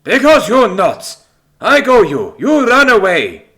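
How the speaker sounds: loud, high, slow outburst; heated, forceful accented growl; angry growl. A narrator's reading. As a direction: growl the accusation in a thick Greek accent. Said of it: "he growls".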